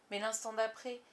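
ordinary delivery, nothing marked

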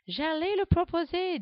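put-on high little girl voice; young girl. A narrator's reading. Alice answering Guillaume, high girlish voice. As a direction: pipe up like a ten-year-old girl.

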